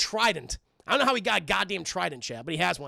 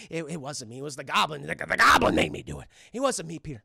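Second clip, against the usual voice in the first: a low cowering lightly voiced plea; pleading Norman; 7 semitones lower than usual. Norman pleading innocence, acted.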